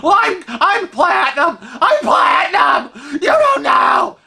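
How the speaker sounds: very high, outraged shriek; hammed-up yell of someone pulling rank; mock screaming. Platinum cruiser boasting at husband, screaming. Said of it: "she's just screaming on and on".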